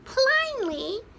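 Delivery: high, loud chick voice; peeping chick. A narrator's reading. Smallest chicken feels cosy, high peep. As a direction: chirp it like a tiny chick. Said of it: "peeped the smallest chicken".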